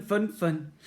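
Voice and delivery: ordinary delivery, nothing marked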